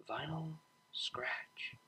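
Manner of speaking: slow breathy whisper of a name; hushed whisper. A narrator's reading. Tender wonder at sleeping friend.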